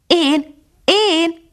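put-on high, eager young dog crying out; puppy shouting up joyfully to the bird; happy cry. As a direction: call out happily, bursting to be noticed. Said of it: "kiáltotta Füstös boldogan".

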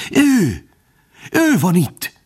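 high, choking gasp of terror; panicked, strangled warning; terror-choked. A narrator's reading. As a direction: force it out high, breath caught by fright. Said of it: "suttogta rémülettől elfúló hangon".

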